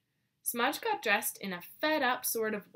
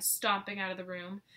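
ordinary delivery, nothing marked

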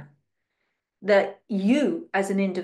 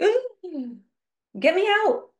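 high, hammed-up cry to be rescued; mock panicked plea; 10 semitones higher, slower than usual. Mocking people desperate to escape the matrix, in a high hammed-up plea. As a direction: squeal it like a helpless victim. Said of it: "I know I'm kind of muppeting around right now".

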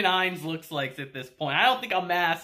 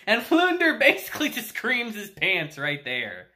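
usual speaking voice

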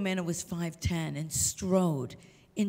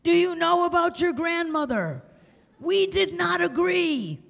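put-on much higher, much louder booming demand from a ninety-year-old; commanding old woman; 11 semitones higher, 9 dB louder than usual. Ninety-year-old cousin confronting the visitor about her grandmother, loud and high. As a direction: boom it out like a towering old matriarch. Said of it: "she came out like this".

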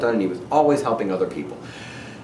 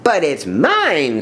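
slow, high protest from a bird; mock scream; 6 semitones higher, slower than usual. A narrator's reading. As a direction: wail it possessively, dragging it out. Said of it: "screamed the bird".

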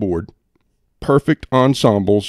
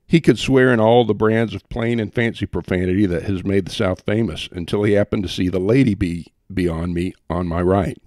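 ordinary delivery

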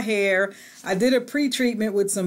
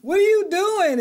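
high, loud call through the door; mock surprised husband; 10 semitones higher, 3 dB louder than usual. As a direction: imitate the husband poking his head in. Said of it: "my husband said".

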